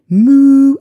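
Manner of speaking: loud, high drawn-out moo; big storybook cow noise. A narrator's reading. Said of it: "And the cow said".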